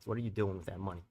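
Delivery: ordinary delivery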